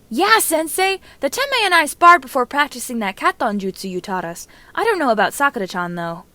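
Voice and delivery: put-on loud, high, excited boyish shout; eager Naruto. Naruto answering his sensei, loud and excited. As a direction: burst out like an eager kid.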